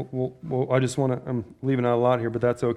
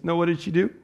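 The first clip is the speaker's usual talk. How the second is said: normal talking voice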